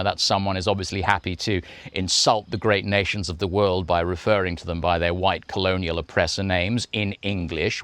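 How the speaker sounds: ordinary talk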